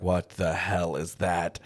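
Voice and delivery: low, slow, quiet disbelief; understated stunned villain, low and slow; baffled Strahd. Acted. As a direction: drop it down and space out each word. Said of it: "even Strahd is like".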